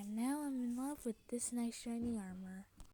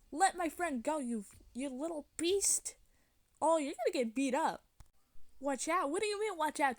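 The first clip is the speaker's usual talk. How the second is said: loud, raised scolding in make-believe fight; play-acted angry confrontation; 4 semitones higher, 5 dB louder than usual. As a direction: tell the villain off loudly, in play. Friend confronts and threatens villain.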